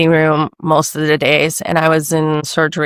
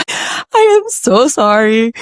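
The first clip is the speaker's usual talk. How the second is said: laughing; 6 semitones higher, 4 dB louder, slower than usual. Retold or imitated. Kid apologising, she laughs.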